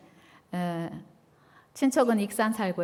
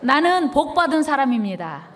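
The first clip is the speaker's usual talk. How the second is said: louder, higher declaration; loud together; 4 semitones higher, 5 dB louder than usual. Shown as a demonstration. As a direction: call it out loud and high with the congregation. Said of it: "다 같이 큰 소리로".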